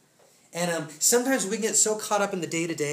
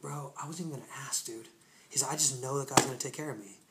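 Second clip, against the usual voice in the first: soft, low retold reply; hushed, low-pitched, humble acceptance; humbled; 5 semitones lower, 8 dB quieter, faster than usual. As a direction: quietly imitate a humbled man. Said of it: "he's already so humbled".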